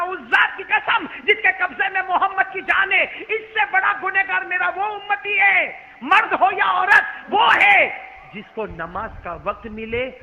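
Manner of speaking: slow, loud, thundering sermon; fiery preaching. Acted. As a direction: hammer each warning home. Preaching at full force. (पूरे जोश से गरजता हुआ बयान)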